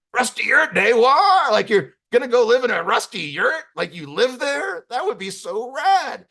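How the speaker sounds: high, loud, hammed-up amazement; thrilled disbelief. [name] pictures living in a rusty yurt, thrilled. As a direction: squeal with wonder, then stretch it out delighted.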